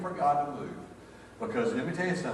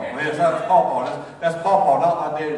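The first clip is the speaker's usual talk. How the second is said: emphatic insistence; 3 semitones higher, 9 dB louder than usual. Retold or imitated. Answering as sons, louder.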